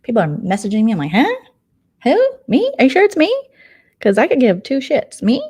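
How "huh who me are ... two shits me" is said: high, loud questioning squeaks; exaggerated high-pitched disbelief; mock bewilderment. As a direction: act baffled at being singled out. Acting own baffled reaction to messages.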